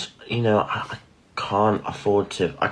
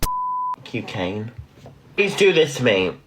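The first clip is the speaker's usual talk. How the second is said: angry rant; 9 semitones higher, 4 dB louder, slower than usual. Real, not acted. Angry rant at Kane on live.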